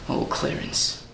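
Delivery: whispered lament; soft mourning whisper; grieving whisper. Acted. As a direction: breathe his name in sorrow. Grieving over Clarence.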